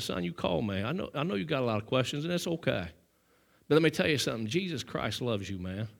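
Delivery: put-on softly telling a young man Jesus loves him; gentle reassurance. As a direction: speak low and kind to the troubled young man.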